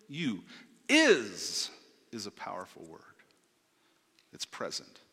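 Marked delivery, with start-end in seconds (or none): 0.9-1.7 IS punched out loud; stress on IS. Shown as a demonstration. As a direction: hit the word Is hard and let it ring. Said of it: "we're gonna put emphasis on the word is".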